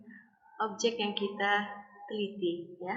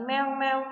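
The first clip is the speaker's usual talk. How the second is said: louder imitated meowing; imitated cat meows; 3 semitones higher, 6 dB louder than usual. Shown as a demonstration. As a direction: meow out loud like a cat. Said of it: "dengar suara kucing". (tiruan suara kucing mengeong keras)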